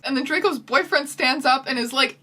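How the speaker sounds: ordinary talk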